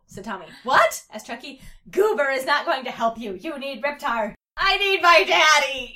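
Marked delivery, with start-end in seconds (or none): 0.6-1.0 high, loud, rising question; hammed-up surprised yelp; shocked. A narrator's reading. Child shocked by refusal. 1.9-4.3 raised, higher, emphatic; acted exasperated kid; heated insistence. A narrator's reading. Heated argument between the children. 4.5-5.9 high, loud, slow wail; hammed-up crying-child shout; tearful yell. A narrator's reading. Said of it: "yelled Chucky as he begun to cry".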